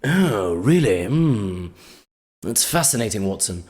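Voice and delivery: low mock-British detective musing; sophisticated British accent. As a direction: muse to Watson in a refined British accent, pitched low. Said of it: "It's just a sophisticated accent".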